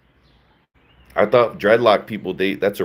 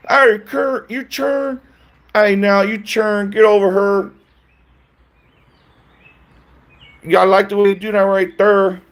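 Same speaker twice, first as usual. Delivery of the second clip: high, loud sing-song rapper voice; 12 semitones higher, 5 dB louder than usual. Pretend. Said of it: "Sounding like [name] and stuff".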